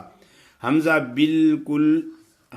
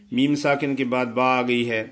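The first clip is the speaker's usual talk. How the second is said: ordinary delivery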